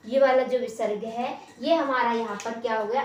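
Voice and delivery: normal talking voice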